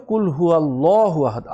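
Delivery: কুল হুয়াল্লাহু আহাদ with a round, full-mouthed llah; full-mouth Allah. Shown as a demonstration. Teacher shows the rounded heavy Allah in Surah Ikhlas. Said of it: "মুখ round হয়ে ফুল মাউথে উচ্চারণ হবে".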